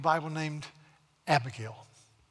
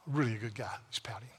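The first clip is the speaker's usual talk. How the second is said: whispered aside; 4 semitones lower, 5 dB quieter, faster than usual. Acted. Mocking aside about the king.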